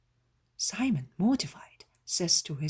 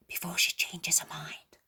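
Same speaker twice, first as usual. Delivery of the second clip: barely audible whisper. A narrator's reading. Servant whispering urgently to the master at the door. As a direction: breathe it, just audible. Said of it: "whispers in a very low, just audible voice".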